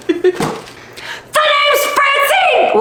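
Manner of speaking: high, very slow shouted name; shouted intro. Acted. Rehearsing Francine's entrance, shouting. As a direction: belt out her name. Blooper, redoing the line.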